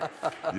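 bright joyful laugh; happy laugh. Real, not acted. Telling the pastor about the gloves, happy laugh. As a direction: laugh out the joy. Delighted at the blessing.

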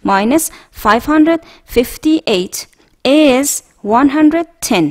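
slow, clear dictation pace; slow model reading. Shown as a demonstration. Teacher reading a subtraction problem aloud for students to repeat, slow. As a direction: spell out each number slowly.

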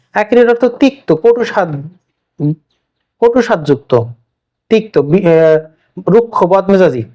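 ordinary talk, nothing marked

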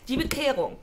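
laut und feierlich angekündigt; feierliche Ansage. Acted. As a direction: den Titel groß verkünden. Ceremonious title announcement. (feierlich laut vorgetragener Titel)

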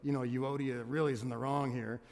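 low, softened sly aside; snarky aside. Pretend. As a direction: slip in a snarky dig under your voice. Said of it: "add any kind of snarky remarks like".

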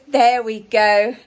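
put-on posh girl praising the interviewer, slow and loud; slow posh approval. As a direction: savour it slowly like a pleased hostess. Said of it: "She says".

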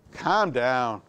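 the rabbi calming the panicked father down, low and dry. Retold or imitated. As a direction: play the unbothered old rabbi. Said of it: "the rabbi looks at me and he says".

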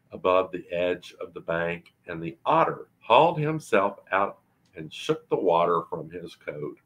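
plain reading voice

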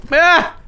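short high cry; quick high scream; startled yelp. Real, not acted. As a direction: yelp at a sudden scare. Another scare in game.

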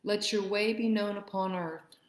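ordinary delivery

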